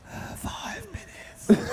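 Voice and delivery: breathy whispered seductive voice; sexy voice. Pretend. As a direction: purr it high and slow, mock sultry. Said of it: "Is that your sexy voice?".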